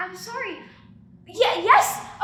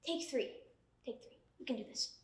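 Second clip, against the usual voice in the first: whispered pep talk; 6 semitones lower, 15 dB quieter than usual. Acted.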